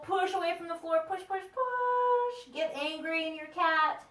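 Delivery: high squeezed coaching cry, last push stretched out; playful high-voiced egging on; high-pitched urging. Coach urging on the angry cat.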